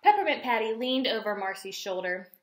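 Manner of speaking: ordinary delivery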